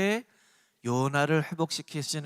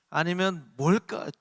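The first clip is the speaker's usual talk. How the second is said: ordinary talk, nothing marked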